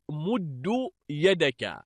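مد ending in short "u", "muddu"; damma reading. Shown as a demonstration. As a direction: say مد يدك slowly as "mud-du yadaka". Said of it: "جعله بمنزلة".

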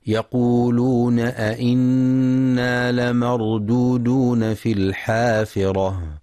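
slow, drawn-out tajweed chanting; measured melodic Quran recitation. Shown as a demonstration. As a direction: recite with full ghunna and long vowels. Recited verse.

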